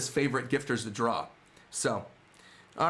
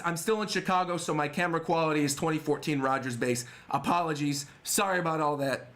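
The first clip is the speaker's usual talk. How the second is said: ordinary talk, nothing marked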